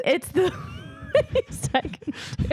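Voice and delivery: breaking up mid-line; laughing. Real, not acted. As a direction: giggle through the words. Reading sweet kid line.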